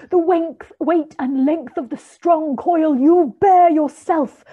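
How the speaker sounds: high, loud wailing spirit; Marley's ghost demanding belief from Scrooge; ghostly voice. A narrator's reading. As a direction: ring it out like a tormented ghost. The ghost speaks.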